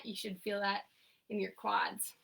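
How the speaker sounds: ordinary delivery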